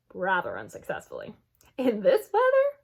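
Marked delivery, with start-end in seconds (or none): 1.8-2.8 doubtful father. A narrator's reading. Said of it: "asked with a frown".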